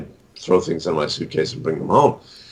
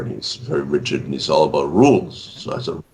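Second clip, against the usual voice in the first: ordinary talk, nothing marked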